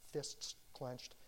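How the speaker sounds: a whispered echo of fists clenched. Shown as a demonstration. Repeated phrase, second whispered.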